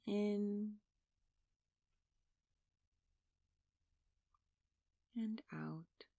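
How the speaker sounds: soft, unhurried meditation voice with long pauses; guided meditation. Real, not acted. Meditation leader asking listeners to close their eyes and breathe, soft guided meditation.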